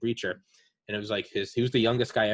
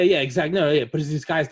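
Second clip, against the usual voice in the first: ordinary talk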